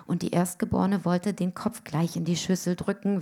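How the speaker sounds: usual speaking voice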